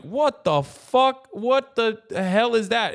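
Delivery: a stranger gawking in amazement; hammed-up shocked cry; startled fan. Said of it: "when someone just goes like".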